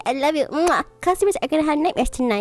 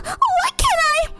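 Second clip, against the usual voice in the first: very high, timid voice; meek Cinderella; slower than usual. Acted. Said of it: "said Cinderella".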